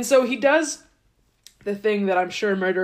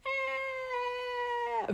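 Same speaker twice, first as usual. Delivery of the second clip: much higher, much softer tiny squeak "Eh"; a droplet's faint little wail; tiny blood droplet cry; 13 dB quieter than usual. Retold or imitated. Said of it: "very quietly".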